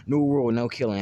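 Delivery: loud, firm mock announcement; imitated declaration. Minato lays down a new rule on hearing Naruto, declaring it loudly.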